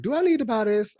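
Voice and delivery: high-pitched self-quote; quoting own question. Retold or imitated. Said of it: "and I'm like".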